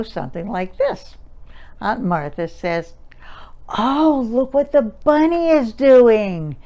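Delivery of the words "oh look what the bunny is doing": high, loud, sing-songy infant-directed voice; sing-songy baby talk. Shown as a demonstration. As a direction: coo it to a toddler over a picture book. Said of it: "Did you notice the sing-songy expression?".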